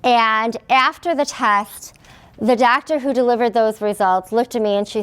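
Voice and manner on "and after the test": ordinary talk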